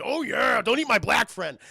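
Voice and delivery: loud, high-energy bursting-in holler; booming mock mascot shout; Kool-Aid Man. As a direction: holler it as you crash through a wall. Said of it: "the house of Kool-Aid Man".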